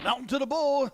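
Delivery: loud, high darts call; excited call. Real, not acted. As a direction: call the hit with a burst of energy. Darts commentator calling a hit.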